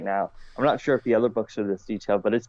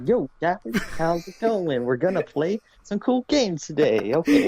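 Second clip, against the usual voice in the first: higher-pitched, goofy dude voice; mock hype greeting, pitched up; 5 semitones higher than usual. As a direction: play a villain as a chummy, uncool rapper. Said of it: "It was like [name]".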